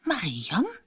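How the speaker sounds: soft, questioning "Marianne", rising at the end; hushed and wondering, lilting upward; puzzled thought. A narrator's reading. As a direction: think it aloud softly, baffled. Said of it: "dacht ze".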